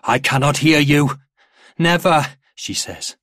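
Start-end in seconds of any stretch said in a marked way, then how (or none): 0.0-1.2 loud, raised challenge; goading shout. A narrator's reading. Father goads his daughter, loud and demanding. 1.8-2.4 "Never" raised, high and loud; a child repeating it more forcefully; louder defiance. A narrator's reading. Daughter answers her father again, louder and defiant. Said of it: "louder this time".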